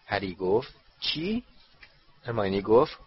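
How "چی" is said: high rising query; puzzled. Acted. Harry hears an unknown school name, puzzled. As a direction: shoot the pitch up in puzzlement.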